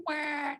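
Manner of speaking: high mock toddler wail; a short, high cry like a toddler bursting into tears; imitated child's wail. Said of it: "the little girl started to cry".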